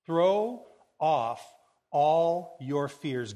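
"throw off", pause, then a long, strong "all"; emphatic, slow declaration. Acted. Preacher savouring Jesus' powerful words, slow stress on "all". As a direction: stretch "all" and declare it firmly.

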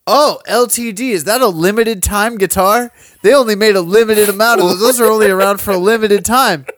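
put-on high and loud, a know-it-all kid's voice; mock clueless kid. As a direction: ham up the clueless fan.

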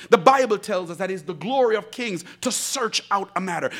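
usual speaking voice

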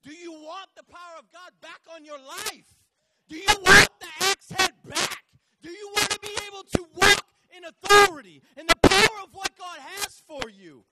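full-force high preacher's shout; say it like you mean it. Real, not acted. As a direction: rouse the church with a roaring challenge. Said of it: "say it like you mean it".

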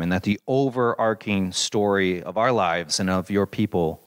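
closing prayer. Acted. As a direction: bring the prayer gently home.

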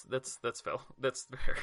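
ordinary delivery

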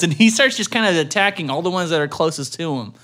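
usual speaking voice

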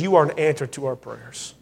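softly voiced tearful stranger, a touch of weeping; retold weeping. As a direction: quietly echo a man moved to tears. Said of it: "And he just began to weep".

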